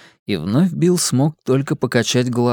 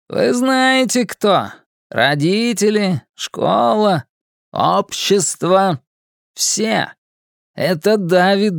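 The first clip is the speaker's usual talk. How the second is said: James Dean impression; 7 semitones higher, slower than usual. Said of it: "неубедительную имитацию Джеймса Дина".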